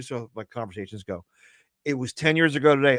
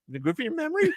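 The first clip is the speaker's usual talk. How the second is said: much higher, puzzled dummy voice; squeaky puppet voice; 13 semitones higher, 3 dB quieter, faster than usual. Acted. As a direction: echo it back as the little puppet. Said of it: "You always repeat it".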